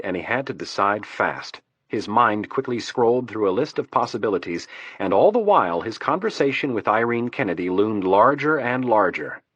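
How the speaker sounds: usual speaking voice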